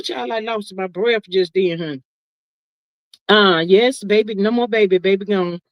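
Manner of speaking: usual speaking voice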